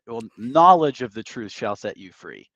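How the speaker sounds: ordinary delivery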